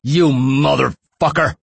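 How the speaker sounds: loud, high, drawn-out curse; agonized yell. A narrator's reading. Prisoner cursing as his hand is smashed, agonized yell. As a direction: yell it slowly, stretching the words in pain. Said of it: "He yelled".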